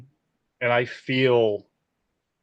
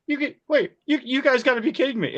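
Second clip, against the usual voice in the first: disbelief; 12 semitones higher than usual. Retold or imitated. DP disbelieving the crew swap.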